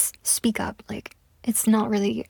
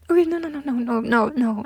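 mock sob; 2 semitones higher, 3 dB louder than usual. Fake crying over his reaction.

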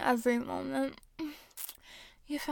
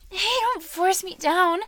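slow, raised, higher-pitched objection; loud protest; 5 semitones higher, 8 dB louder than usual. Acted. Woman objects to her partner, loud protest. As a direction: object loudly, stretching the words.

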